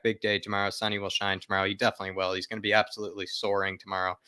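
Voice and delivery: ordinary talk, nothing marked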